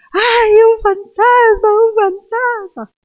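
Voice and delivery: high, loud, drawn-out mock scream; scream of fear. Preacher acting out the disciples on seeing a ghost, screaming in fear. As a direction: wail it out in hammed-up terror. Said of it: "se pusieron a gritar de miedo".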